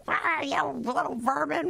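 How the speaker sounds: high, drawn-out mock yell; hammed-up scolding yell, no real volume; retold yell. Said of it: "So I yell".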